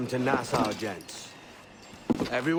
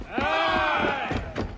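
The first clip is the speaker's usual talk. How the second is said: shouted aye; 12 semitones higher than usual. Acted. Crew answers the captain's vote, shouting. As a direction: roar it back like a crowd.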